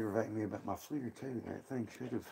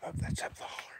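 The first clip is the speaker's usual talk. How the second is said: whispering; faster than usual. Real, not acted. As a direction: keep whispering while listening. Listening to howls outdoors at night.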